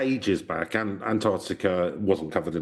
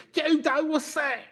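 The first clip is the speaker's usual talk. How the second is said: very high, squawking soap character; mock EastEnders local; slower than usual. Local gossips about the Russian newcomer, squawky EastEnders voice. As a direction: caricature a nosy soap gossip.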